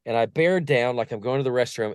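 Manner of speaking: usual speaking voice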